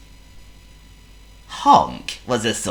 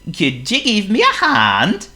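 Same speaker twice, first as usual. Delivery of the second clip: Honk the seal; 6 dB louder than usual. Acted. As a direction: play a polite silly seal. Honk's voice.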